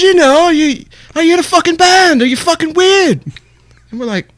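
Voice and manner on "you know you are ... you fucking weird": put-on high, louder British accent; imitating Benji's British accent. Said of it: "I've taken his accent".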